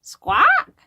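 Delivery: very high squawk; parrot squawk. Acted. Reader imitating a parrot for the kids, high squawk.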